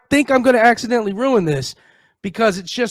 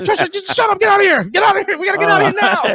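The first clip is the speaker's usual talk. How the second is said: mock frantic urging, pitched up; laughing urgent panic; 11 semitones higher than usual. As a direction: hurry her away in laughing alarm. Fleeing embarrassed, retold to sister.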